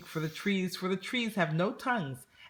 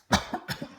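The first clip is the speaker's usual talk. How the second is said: cough. A narrator's reading. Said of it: "He coughed".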